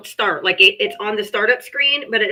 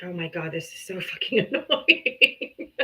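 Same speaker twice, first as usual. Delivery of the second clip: laughing exasperation; 4 semitones lower, 6 dB quieter, slower than usual. Real, not acted. Annoyed, laughing at phone.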